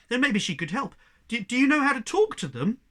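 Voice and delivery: high, loud, stumbling hope; eager. A narrator's reading. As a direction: rush out the question eagerly. Young cat excited and stumbling.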